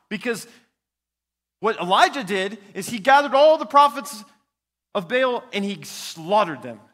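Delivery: loud, high fiery preaching; preaching at full force. Acted. Preacher telling the church how Elijah slaughtered Baal's prophets, fiery loud preaching. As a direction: thunder it out from the pulpit.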